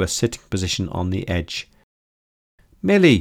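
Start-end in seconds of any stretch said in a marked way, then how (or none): 2.8-3.2 high, loud cry of joy; joyful exclamation. A narrator's reading. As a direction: call her name, delighted to see her. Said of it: "she exclaimed in joy".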